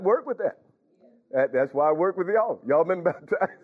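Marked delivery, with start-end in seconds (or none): 2.7-3.5 asked through a laugh; laughing question. Real, not acted. Preacher teasing the congregation, laughing mid-question. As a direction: let the laugh break the question. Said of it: "this is why I talk this way".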